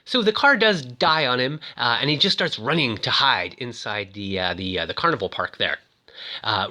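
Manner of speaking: ordinary talk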